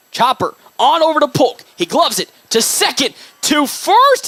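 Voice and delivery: sportscaster's quick excited call; excited play call. Real, not acted. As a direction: ride the play with the fielders. Live call of a fielding play.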